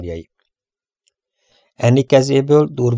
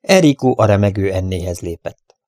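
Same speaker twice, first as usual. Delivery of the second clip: plain reading voice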